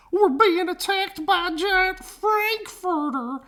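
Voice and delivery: high yelp for help; panicked cry. A narrator's reading. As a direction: squeal it like a scared cat. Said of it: "cried the cats".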